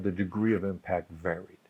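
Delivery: "degree" lifted softly, said quietly; soft restatement. Shown as a demonstration. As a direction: drop the volume and restate it gently.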